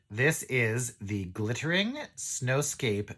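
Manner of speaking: very slow, deliberate enunciation; slow, careful. Real, not acted. Knitter having trouble saying the shawl's name, very slow and careful. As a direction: pick carefully through a tricky name. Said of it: "I'm having trouble saying it".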